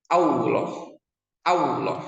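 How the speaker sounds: Allah said as "aulo", rounded W sound; Allah with a wrong W glide. Shown as a demonstration. Teacher demonstrates common mistake, Allah with W. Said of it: "Dan itu salah".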